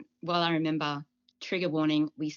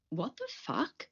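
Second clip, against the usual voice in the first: quiet, higher-pitched mock bafflement; imitated disbelief; 8 semitones higher, 6 dB quieter, slower than usual. Mother quoting her children's reaction to the news, mock disbelief. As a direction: voice a child's hushed, high disbelief.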